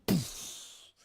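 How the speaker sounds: airy puff of a cannon shot; imitated cannon poof. Host imitating seeds fired from a cannon, airy poof. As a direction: blow out a breathy "poof".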